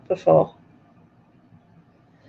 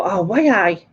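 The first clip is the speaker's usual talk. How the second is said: Geordie exclamation. Retold or imitated. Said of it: "I go".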